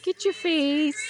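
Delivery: ordinary delivery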